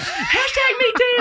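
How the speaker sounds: slow, very high falsetto squeal; hammed-up falsetto screech; mock girl scream. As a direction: draw it out like an imitated shrieking girl. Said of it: "whatever girls scream".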